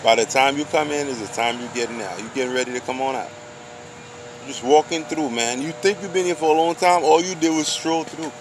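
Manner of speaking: ordinary talk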